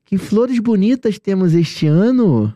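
slow, lilting line with a swooping ANO; theatrical clue-giving tune, stretched through to "ano"; exaggerated intonation. Shown as a demonstration. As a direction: draw out "Que flores bonitas" and swoop up then down on "ano". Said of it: "A entonação".

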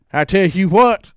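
loud hammed-up cowboy voice; cowboy drawl. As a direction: play it like a Western cowboy. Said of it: "talk like a cowboy".

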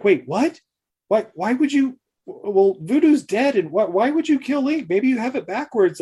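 high, incredulous questions; shocked protest. Retold or imitated. Woman objects to Bailey killing Link, high disbelieving voice. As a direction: push back in disbelief.

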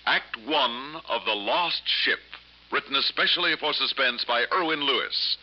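booming dramatic radio intro; old radio announcer. Acted. Suspense show announcer intro.

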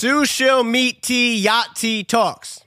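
slow, puzzled misreading; misread slowly. Shown as a demonstration. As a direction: sound it out word by word. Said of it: "you're saying the wrong word".

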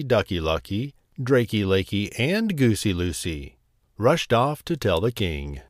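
plain reading voice

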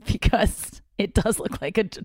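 usual speaking voice

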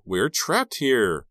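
loud, high, drawn-out wail; panicked cry. A narrator's reading. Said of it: "Maya cried".

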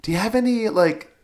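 high, careful coach voice. Retold or imitated. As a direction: mimic the older coach's prying question. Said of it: "she goes, she's like".

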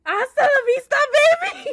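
loud, high movie catchphrase cry; triumphant shout. Real, not acted. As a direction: belt it out like you cracked it.

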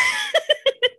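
very high shrieking laugh; high squeal of laughter. Real, not acted. Laughing at her own Caroline outburst, shrieking high.

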